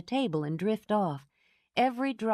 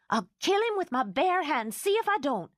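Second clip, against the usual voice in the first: furious vow; 10 semitones higher than usual. Acted. Anne vows revenge.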